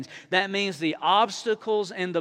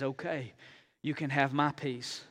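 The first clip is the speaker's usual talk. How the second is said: much softer, gentle God's voice; calm reassurance; 4 semitones lower, 8 dB quieter than usual. Retold or imitated. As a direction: hand it over calmly. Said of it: "and he says".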